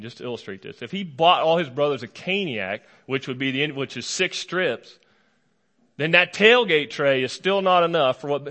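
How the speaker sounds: ordinary talk, nothing marked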